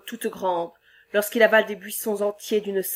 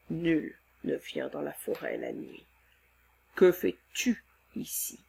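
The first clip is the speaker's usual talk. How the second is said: calm him like a kind giant; gentle monster voice; 4 semitones lower, 6 dB quieter than usual. Acted. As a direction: monster soothing the frightened man. Monster speaking low.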